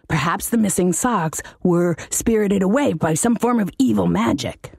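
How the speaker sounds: a servile demon wheedling his master; pleading demon. Acted. Demon character voice.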